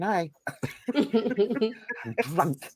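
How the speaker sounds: ordinary talk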